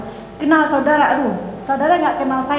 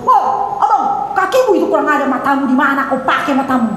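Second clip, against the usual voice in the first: brusque Batak scolding. Acted. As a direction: scold like a blunt Batak, hammed up. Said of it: "Orang Batak katanya nggak lemah lembut".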